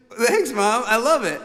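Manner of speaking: high, loud mock-gracious thanks; polite thanks. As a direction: put on a polite show of delight. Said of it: "And I was like".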